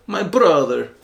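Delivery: mimicking a thick Italian accent; playful put-on Italian accent, as a workplace catchphrase. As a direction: imitate the Italian coworker's accent. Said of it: "in his thick Italian accent".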